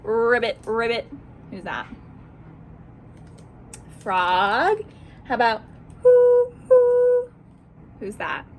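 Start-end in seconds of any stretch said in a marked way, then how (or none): 0.0-1.1 frog ribbit. Shown as a demonstration. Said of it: "match the animal sounds". 6.0-7.3 high, loud hooting owl; playful owl call; owl hoots. Shown as a demonstration. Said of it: "match the animal sounds".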